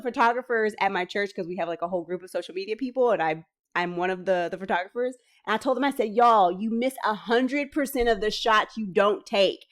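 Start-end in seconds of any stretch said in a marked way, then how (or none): none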